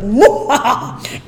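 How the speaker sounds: slow evil villain cackle; villain laugh. Retold or imitated. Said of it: "I was like".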